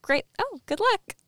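a hammed-up distracted diva, barely listening; mock dismissive Sharpay. Sharpay dismissing Troy on phone.

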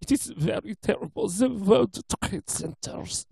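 imitated thick German accent, slowing midway. German custodian telling students of the attack, imitated thick German accent. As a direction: lay on a heavy German accent in homage. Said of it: "she has very thick German accent".

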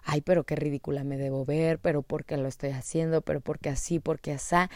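second-guess yourself under your breath; self-doubting inner monologue. Retold or imitated. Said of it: "Estaba racionalizando".

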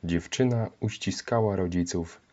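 ordinary delivery, nothing marked

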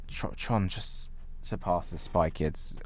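normal talking voice

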